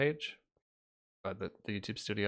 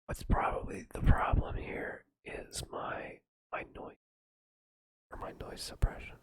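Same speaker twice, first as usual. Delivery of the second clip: whisper. Shown as a demonstration. Streamer explaining audio, still whispering. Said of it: "talk like this".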